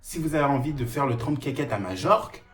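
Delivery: high ranting voice; mock rant. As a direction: rant at the viewers. Sudden ranting rant persona.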